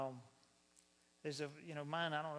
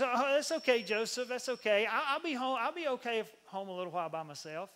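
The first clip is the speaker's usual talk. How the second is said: higher, louder wife's voice; Mary; 4 semitones higher, 7 dB louder than usual. Acted. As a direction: brush it off brightly, high and loud. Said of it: "and Mary says".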